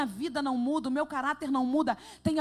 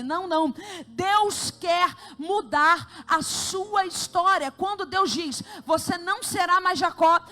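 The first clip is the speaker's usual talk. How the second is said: loud, climbing proclamation of God's promise; fiery preaching; 6 dB louder than usual. Acted. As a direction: build it up, declaring to the church.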